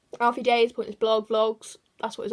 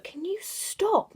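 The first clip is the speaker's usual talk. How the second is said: much higher, stretched out slowly; exasperated call-out; 10 semitones higher, much slower than usual. Real, not acted. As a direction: drag it out at someone annoying you. Telling someone off mid-video.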